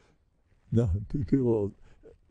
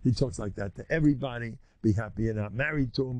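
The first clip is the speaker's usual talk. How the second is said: normal talking voice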